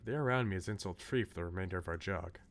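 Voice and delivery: normal talking voice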